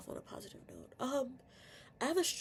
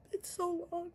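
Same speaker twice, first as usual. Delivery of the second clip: crying; 7 semitones higher than usual. Real, not acted. Remembering singing with her dad, crying. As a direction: voice breaking into tears.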